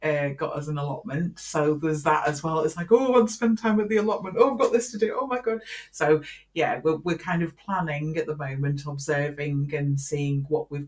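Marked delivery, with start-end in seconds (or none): 2.9-5.6 higher, hurried self-mimicry; mock flustered. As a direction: act out being pulled between tasks. Mimics being pulled between tasks.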